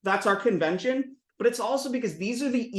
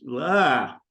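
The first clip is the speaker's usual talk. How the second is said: ordinary talk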